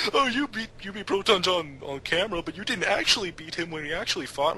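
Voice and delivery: high, whiny mock accusation; mocking complaint. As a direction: sneer it like an annoyed fan. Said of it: "people get pissed off and it's like".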